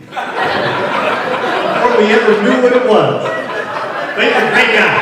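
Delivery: slow, loud, laughing delivery. Real, not acted. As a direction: laugh while you tell it. Laughing about the AD.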